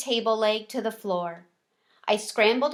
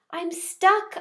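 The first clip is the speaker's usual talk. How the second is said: scared; 8 semitones higher than usual. Acted. Hamster hanging in midair.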